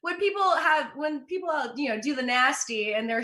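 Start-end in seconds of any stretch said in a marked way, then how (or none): none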